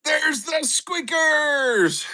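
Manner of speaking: high, slow, showman announcement; announcer call. Acted. Host presenting the treats to the dog, slow and high. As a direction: draw it out like presenting a prize.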